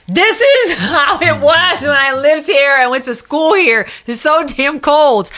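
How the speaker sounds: mock-complain through a laugh; laughing complaint. Complaining about Boston cold.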